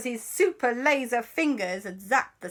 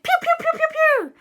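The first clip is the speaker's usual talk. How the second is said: very high, loud shots; laser zaps; 7 dB louder than usual. Acted. Children fire their iPads at the ghost, squeaky zapping. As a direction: squeal out a quick volley.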